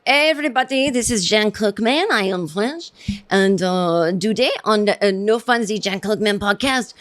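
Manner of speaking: hammed-up French accent, speaking confidently; terrible French accent. Shown as a demonstration. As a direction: put on a bad French accent. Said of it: "doing a terrible accent".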